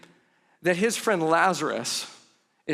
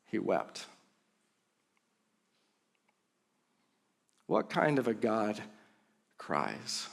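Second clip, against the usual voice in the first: low, hushed and slow; almost whisper it, grave; much softer, lower solemn question; 8 semitones lower, 9 dB quieter, slower than usual. Real, not acted. Pastor drops to a solemn hush.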